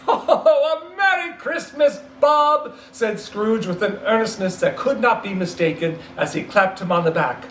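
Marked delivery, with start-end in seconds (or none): 0.1-2.7 high, loud, beaming well-wishing; joyful greeting. Acted. Scrooge clapping Bob on the back, joyful. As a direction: wish him well with all your heart.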